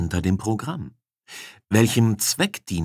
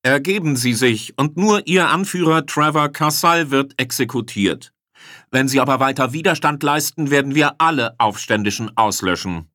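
raised, higher commander's voice; forceful public demand to surrender; booming ultimatum; 7 semitones higher, 4 dB louder than usual. A narrator's reading. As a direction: proclaim the threat as if amplified for all to hear. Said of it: "drang eine dröhnende, akustikfeldverstärkte Stimme".

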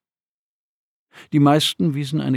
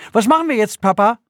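put-on high, loud childish question; eager boy; 10 semitones higher, 5 dB louder, faster than usual. A narrator's reading. Boy asks his father what comes next, eager. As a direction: ask like an excited kid.

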